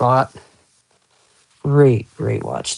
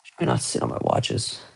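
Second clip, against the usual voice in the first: whispered aside; 7 semitones lower, 8 dB quieter than usual. Real, not acted. Aside while streaming.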